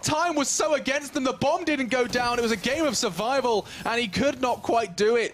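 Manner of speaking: high, slowed emphatic commentary; excited recap. Real, not acted. Caster recaps a game of survival, excited.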